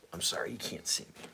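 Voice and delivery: hushed aside whisper. Real, not acted. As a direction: drop to a whisper for a quick apology.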